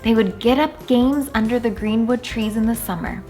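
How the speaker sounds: ordinary delivery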